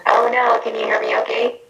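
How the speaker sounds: echoing, doubled bad audio; talking into a trash can. Real, not acted. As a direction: voice smeared by reverb. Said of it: "I hear you and about sixteen other people".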